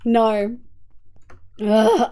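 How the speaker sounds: ordinary talk, nothing marked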